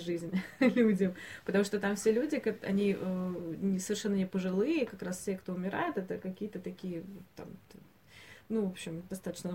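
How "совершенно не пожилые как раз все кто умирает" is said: normal talking voice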